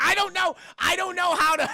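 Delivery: high, loud comic protest; exasperated outburst. Acted. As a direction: holler it in mock exasperation. Acted frustration about not speaking Spanish.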